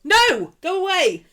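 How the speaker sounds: high, loud shooing off; exasperated shout. Real, not acted. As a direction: shout it to send them packing. Shooing off Siri, triggered by accident.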